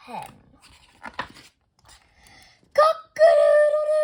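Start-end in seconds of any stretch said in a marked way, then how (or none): 2.7-4.0 high, loud crooning cock-a-doodle-doo with a warble and growl; crooning rooster crow. A narrator's reading. Clyde finally crowing his own crooning crow. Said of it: "not so much like crowing, but crooning".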